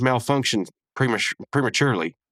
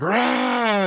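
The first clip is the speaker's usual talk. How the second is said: high, drawn-out mock roar; imitated wrestler roar; 13 semitones higher than usual. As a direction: let out a pitched-up wrestler's "rah". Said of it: "he comes out and he's like".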